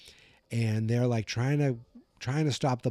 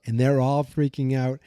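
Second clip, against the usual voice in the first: usual speaking voice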